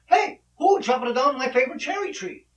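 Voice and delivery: high, loud demand in mock Italian; hammed-up Italian accent, father calling out; angry Italian pop. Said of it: "And his pop asked".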